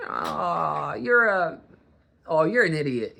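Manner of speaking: fond, laughing "you're an idiot" said kindly; affectionate teasing. Shown as a demonstration. Showing the friendly way to say "you're an idiot". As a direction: tease him like a buddy. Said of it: "there's a difference".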